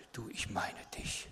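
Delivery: soft whisper; still small voice. Acted. God speaking gently to the listener, soft whisper. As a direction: whisper it like God's gentle murmur. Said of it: "im stillen, sanften Säuseln".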